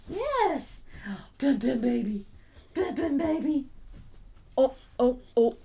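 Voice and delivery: high cooing to a dog. Real, not acted. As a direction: coo at the dog. Baby talk to other dog.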